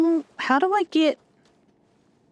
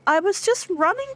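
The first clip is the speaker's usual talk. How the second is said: normal talking voice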